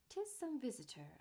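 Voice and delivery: ordinary delivery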